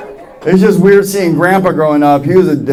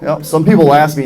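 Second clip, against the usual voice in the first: ordinary delivery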